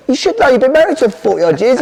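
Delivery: high, incredulous telling; exasperated son. Retold or imitated. Son telling his confused dad he's married, high and incredulous. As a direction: spell it out in disbelief. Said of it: "I went".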